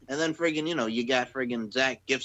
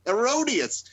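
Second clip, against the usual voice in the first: Vince Vaughn impression; 8 semitones higher, 4 dB louder, slower than usual. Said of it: "That's like Vince Vaughn and Wedding Crashers".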